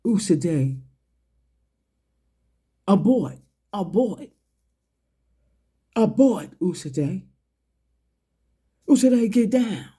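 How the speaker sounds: half-hearted mock yell of a warning, rising on the last calls; retold yell. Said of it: "that yelled".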